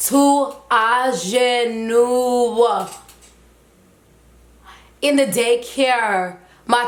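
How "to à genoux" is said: very slow, stretched-out order; retold command, dragged right out; drawn-out command. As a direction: imitate a parent stretching each syllable. Father orders child to kneel.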